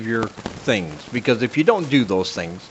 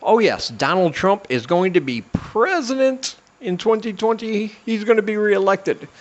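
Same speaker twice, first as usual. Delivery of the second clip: mock voice of God; 8 semitones higher than usual. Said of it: "his God had told him personally".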